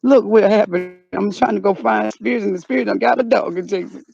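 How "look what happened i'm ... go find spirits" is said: ordinary delivery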